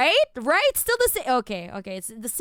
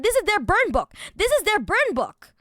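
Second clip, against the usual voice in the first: emphatic repeat; 8 semitones higher, 5 dB louder than usual. Real, not acted.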